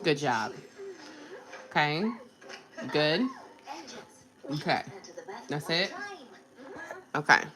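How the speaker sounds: soft, tender encouragement; gentle praise. Real, not acted. Mom praising her little daughter, soft and tender.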